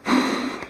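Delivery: long audible sigh. A narrator's reading. Pop star alone in her trailer, sighing. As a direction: let out a soft, breathy sigh. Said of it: "Kira sighed".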